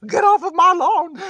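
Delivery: high, full-volume mock scream; screaming old man. Streamer acting out the dog as an old man, screaming. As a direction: scream it like an outraged ninety-three-year-old. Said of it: "screaming at the top of his lungs".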